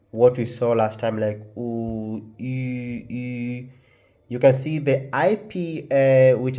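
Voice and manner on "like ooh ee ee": EE held long and softly with the lips rounded as for OOH; rounded French U. Shown as a demonstration. Teacher showing learners how to make the French U, soft rounded vowels. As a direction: glide from "Ooh" into a tight, lip-rounded "ee". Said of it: "round your lips as if".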